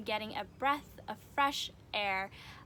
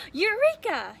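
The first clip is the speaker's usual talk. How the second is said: loud, high cry of discovery; shouted; 10 semitones higher, 10 dB louder than usual. Acted. Stu hit by an idea, excited shout. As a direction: shout it as a sudden brainwave. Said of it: "he shouted".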